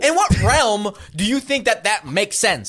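high, loud disbelieving rant; incredulous. Real, not acted. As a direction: demand it in total disbelief.

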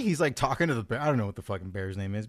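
normal talking voice

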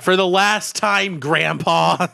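loud, high, fed-up yell; exasperated shout. Acted. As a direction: snap at a nagging grandpa. Frodo snapping at Bilbo.

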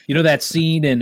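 normal talking voice

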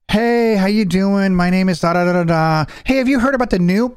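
high, sing-out phone greeting; chirpy caller voice. Retold or imitated. Customer finally reaching EVGA support, high chirpy greeting.

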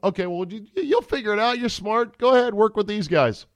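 high, patronizing mock voice telling Brady to manage; hammed-up sing-song reassurance; mock coaching pep talk. Team bosses to Brady, patronizing high mock voice. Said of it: "Tom Brady is being told".

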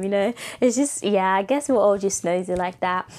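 ordinary talk

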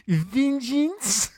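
"vengeance" stretched out slow and high; mock the word "vengeance" with long, high vowels; mimicked pronunciation. Showing co-host how they said it, exaggerated mimicry of "vengeance". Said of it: "it's kind of".

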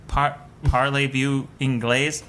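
put-on slow, halting French in a thick American accent; dumb American accent. Asking strangers in Paris if they speak English, dumb American accent. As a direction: mangle the French like a clueless American. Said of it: "like, the dumbest American accent".

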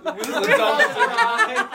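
high, laughing plea; half-laughing warning to friends; giggling through the protest. Retold or imitated. Narrator urging friends to stop.

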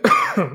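sharp high choking cough. Real, not acted. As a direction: cough as if something went down wrong. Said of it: "coughing and choking on myself".

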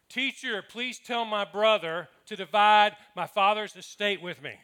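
loud, slow, calling out to the teacher; calling from the crowd. A narrator's reading. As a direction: call it out over a crowd. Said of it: "someone called from the crowd".

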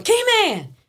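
high-pitched calling out for the key man; drawn-out high holler; retold screaming. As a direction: yell it sharply upward, like calling down a hallway. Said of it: "I'm jumping and screaming".

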